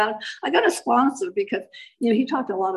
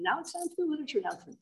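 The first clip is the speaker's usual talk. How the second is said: quick, high, breezy announcement; lightly voiced meeting announcer; mock announcer; 7 semitones higher, 10 dB quieter, faster than usual. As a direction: rattle it off like a proud chairperson.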